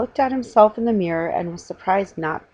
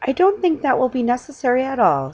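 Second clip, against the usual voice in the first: higher, louder and quick; hurried; 7 semitones higher, 4 dB louder than usual. Acted. Mrs Brown hurrying to stop the salesman, hurried. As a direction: rush to head him off. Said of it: "said Miss Brown hurriedly".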